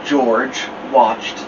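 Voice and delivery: ordinary delivery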